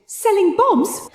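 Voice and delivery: high, slow disbelieving echo; incredulous. Acted. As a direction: repeat the title in disbelief. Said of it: "incredulous echo of title".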